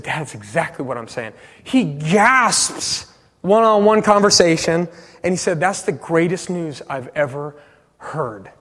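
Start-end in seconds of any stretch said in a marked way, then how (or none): 0.0-1.3 much softer and lower; hushed confirmation. Retold or imitated. Son confirming the gospel to his dad, hushed. 5.6-8.5 low, soft, sinking to almost nothing; hushed awe. Retold or imitated. Dad receiving the good news, hushed awe.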